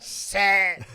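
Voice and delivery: loud, high starting yell. Acted. Starter yelling at the grand final bounce, loud yell. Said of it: "and yells out".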